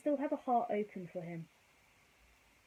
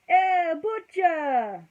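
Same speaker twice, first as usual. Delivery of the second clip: put-on slow, loud Italian-accented greeting; 9 semitones higher, 13 dB louder, much slower than usual. Tony greets the family walking into his restaurant, loud slow Italian accent. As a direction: stretch out a big hearty Italian hello. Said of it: "Apologies for the Italian accent".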